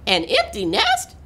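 high incredulous hen; chicken's cry. A narrator's reading. Chicken answering the cat, crying out in disbelief. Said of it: "cried the chicken".

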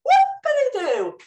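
high, loud squeaky puppet whoop; squeaky puppet cheer. Acted.

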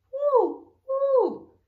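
high, loud hooting; owl hoot. A narrator's reading. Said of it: "asked the owl".

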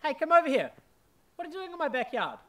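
indignant demand; confronting. Acted. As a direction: call out the intruder. Confronting intruder in backyard.

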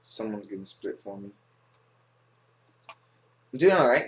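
ordinary talk, nothing marked

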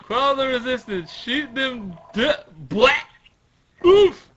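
high, loud command cut short by a blow; shouted battle order. Acted. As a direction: bellow the order, then break off. Resumes acted battle order, then hit.

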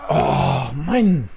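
frustrated. Real, not acted. After the chase.

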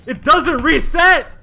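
high, loud frustrated shout; outraged yelling. Real, not acted. Gamer yelling about having only one jump, outraged. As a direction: yell it at the game in despair.

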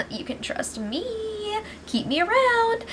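high, slow, soft imitation; mocking high-pitched sweet talk; ingratiating mock plea. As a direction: wheedle in a high put-on voice. Mock-acting a houseguest's ingratiating plea.

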